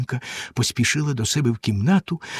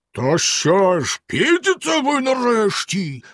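slow, much higher furious bellow; furious yell; 9 semitones higher, slower than usual. A narrator's reading. Bluebeard yells from below, furious. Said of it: "розлючено загорлав знизу Синя Борода".

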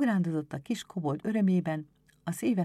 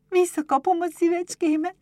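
put-on high, loud child's cry of hope; hopeful cry; 13 semitones higher, 8 dB louder than usual. A narrator's reading. Berbel sees the marble hit, high hopeful cry. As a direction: squeal it high like an excited girl. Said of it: "kiáltotta reménykedve".

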